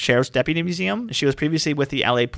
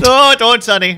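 higher, louder hammed-up old geezer; mock old-timer; 9 semitones higher, 7 dB louder than usual. Teasing the co-host, as a loud hammed-up old-timer. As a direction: crank up the old geezer, calling him Sonny.

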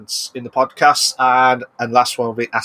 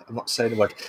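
quieter repeat; 3 semitones lower, 9 dB quieter, faster than usual. Pretend.

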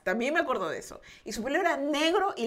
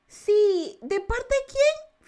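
relative on the phone; 10 semitones higher, 5 dB louder, much slower than usual. Retold or imitated.

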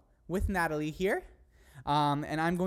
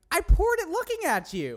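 indignant protest; 13 semitones higher, 7 dB louder than usual. Real, not acted. Protesting she didn't cheat.

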